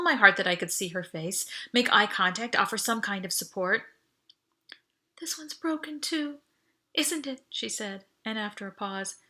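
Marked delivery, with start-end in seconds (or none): 5.2-7.4 higher, soft fearful whisper; frightened whisper. Acted. Audrey dreading the broken airlock, scared whisper. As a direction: breathe it out, afraid of the answer.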